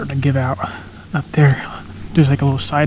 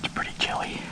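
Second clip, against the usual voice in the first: whispering; 2 semitones higher, 13 dB quieter than usual. Real, not acted. Quiet hunter in a tree stand.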